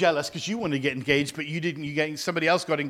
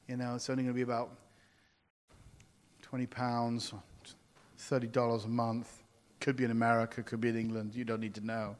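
soft, low, fast sly hinting; mock sly hint; 7 semitones lower, 8 dB quieter than usual. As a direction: slip the hint in softly. Mimicking a manipulative hint.

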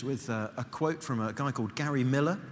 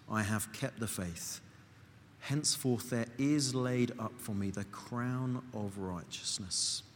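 closing prayer; 4 semitones lower than usual. Acted. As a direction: pray it gently to God, unhurried.

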